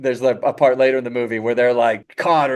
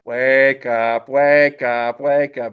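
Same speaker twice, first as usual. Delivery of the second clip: each "wake up" stretched out at usual pitch; slow-motion waking call; much slower than usual. Acted. Zhen still waking Po, slow drawn-out call. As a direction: stretch out every word, slowly and evenly. Same words, slowed down.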